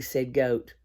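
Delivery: ordinary delivery, nothing marked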